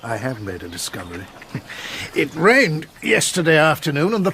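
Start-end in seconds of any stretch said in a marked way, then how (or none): 1.5-2.1 quiet breathy chuckle; a small amused laugh to himself; soft chuckle. Acted. Inspector chuckles over his muddy-path find.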